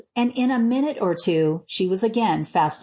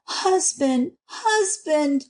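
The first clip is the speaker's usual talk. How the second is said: higher-pitched, mildly pleading cry; 7 semitones higher, much slower than usual. A narrator's reading. Wife clings to her husband's neck, crying out. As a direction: call to him with a touch of distress. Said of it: "she cried".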